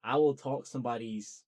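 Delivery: ordinary delivery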